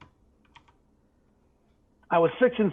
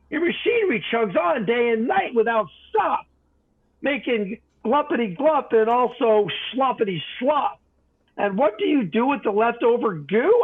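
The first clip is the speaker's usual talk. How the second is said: angry Lorax; 7 semitones higher than usual. A narrator's reading. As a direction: scold him with your dander up. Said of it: "snapped the Lorax, his dander was up".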